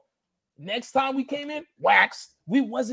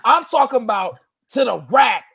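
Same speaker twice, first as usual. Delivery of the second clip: ordinary delivery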